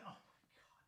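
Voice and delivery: a voiceless gasp of disbelief; aghast whisper. Real, not acted. Streamer horrified by a chat name, whispering.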